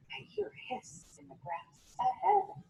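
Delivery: softly whispered warning; hushed whisper. Acted. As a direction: gruffalo hushing at a sound. Gruffalo hears a snake.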